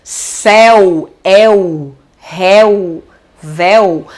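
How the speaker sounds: "Céu, el, réu, véu" as separate words, vowel wide open; open diphthong. Shown as a demonstration. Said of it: "vai ter o som aberto".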